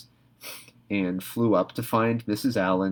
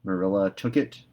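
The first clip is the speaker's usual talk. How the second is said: usual speaking voice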